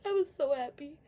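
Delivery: happy tears breaking through; tearful. Real, not acted. Telling viewers about her dad, happy crying. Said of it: "happy tears".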